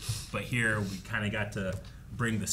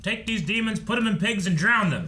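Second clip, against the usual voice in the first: mock prayer; 11 semitones higher, 6 dB louder than usual.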